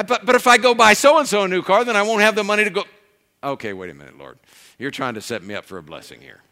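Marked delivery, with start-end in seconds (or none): 0.0-2.8 high, loud, fast protest. Retold or imitated. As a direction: blurt out the objection in alarm. Arguing with the Lord, protesting.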